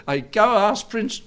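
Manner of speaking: high, loud put-on voice to Prince; mock question to Prince. Pastor pretends to ask Prince Charles, high and loud. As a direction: play the one asking the prince.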